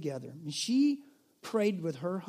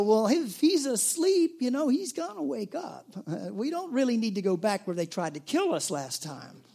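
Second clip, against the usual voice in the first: dim disciples; 5 semitones higher, 4 dB louder than usual. Retold or imitated. The disciples answer Jesus about Lazarus, high mock-dimwit voice. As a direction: play the clueless disciples, hammed-up. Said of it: "the bright students that the disciples were, um, they go".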